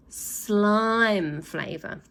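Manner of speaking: very slow drawn-out offer; teasing slime flavour. Acted. Boy teases villains with slime flavour, very slow. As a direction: draw it out teasingly.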